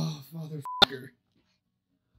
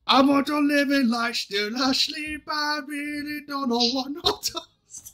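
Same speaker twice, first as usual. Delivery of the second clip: attempted Jamaican accent; 7 semitones higher, 3 dB quieter than usual. Singing to friends on a podcast, in a put-on Jamaican accent. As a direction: try a reggae accent, singing it out. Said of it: "she wanted me to do the accent, so I tried the accent".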